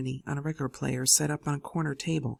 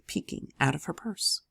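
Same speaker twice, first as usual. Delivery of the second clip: ordinary delivery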